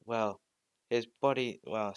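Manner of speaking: ordinary delivery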